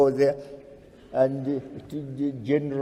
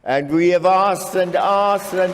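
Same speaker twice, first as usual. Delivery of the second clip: loud, raised-pitch oratory; impassioned protest; 4 semitones higher, 6 dB louder than usual. Real, not acted. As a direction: drive each repetition home with real frustration. Orator protesting repeated refusals.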